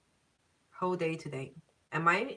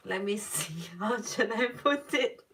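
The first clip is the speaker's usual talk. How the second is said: laughing through the words; can't hold back a giggle; half-laughed question; 3 semitones higher than usual. Real, not acted. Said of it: "I don't mean to laugh".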